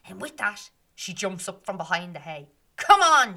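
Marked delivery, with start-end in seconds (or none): 2.8-3.4 much louder, higher dare; shouting challenge. Acted. Meg daring the fairies to fight, a loud shout. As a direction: bellow it as a challenge. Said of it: "she shouts".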